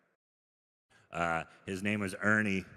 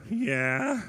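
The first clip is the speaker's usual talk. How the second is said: squeaky high yeah, much higher; high chirpy yeah; 11 semitones higher, 4 dB louder than usual. Retold or imitated. To the judgy stranger, a squeaky high yeah. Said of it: "that's how I talk to strangers".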